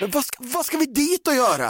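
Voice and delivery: high, whining objection; mock protest. Boyfriend protesting an Ikea trip, high mock whine.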